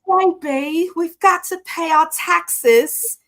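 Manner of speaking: high, loud mock pretentious accent, drawn out; Dorit. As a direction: put on a hammed-up, unplaceable fake accent. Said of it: "I don't understand the access, the accent either".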